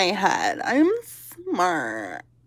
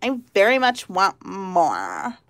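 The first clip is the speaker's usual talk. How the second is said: mildly mock a prim classmate asking for more; snooty schoolgirl. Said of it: "the girls that I went to school that sounded like".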